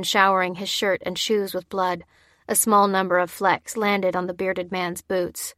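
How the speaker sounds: ordinary delivery, nothing marked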